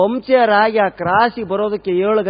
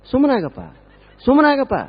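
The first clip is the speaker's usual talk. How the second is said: building consolation; 8 semitones higher, 5 dB louder than usual. Retold or imitated. Village men consoling, building.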